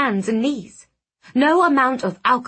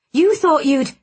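choked; 7 semitones higher, 6 dB louder than usual. Acted. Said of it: "I choked on the word".

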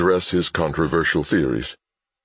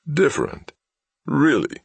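high, scornful incredulity; mocking disbelief; 10 semitones higher than usual. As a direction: scoff at the idea. Villain mocking hostage's claim.

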